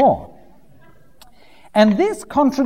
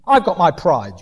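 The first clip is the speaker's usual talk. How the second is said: usual speaking voice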